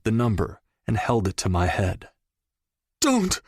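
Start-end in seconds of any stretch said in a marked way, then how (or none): 3.0-3.4 high, panicked cry; desperate plea. Acted. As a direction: beg him to stop, heaving. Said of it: "he heaved".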